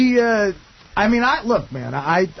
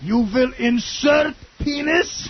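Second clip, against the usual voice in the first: high, slow mock German order; mock German accent; 7 semitones higher, much slower than usual. Mocking the German girl's demands, slow mock German accent. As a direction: command it stiffly.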